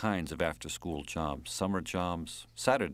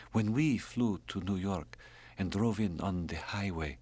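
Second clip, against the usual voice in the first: ordinary delivery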